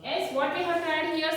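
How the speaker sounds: ordinary talk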